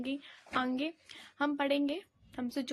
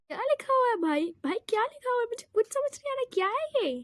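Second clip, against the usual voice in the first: higher pitched baffled complaint; what on earth is this, rising; exasperated confusion; 7 semitones higher, 4 dB louder than usual. Real, not acted. Can't understand the textbook.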